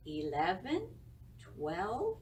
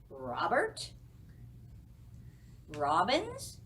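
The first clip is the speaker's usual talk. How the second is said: normal talking voice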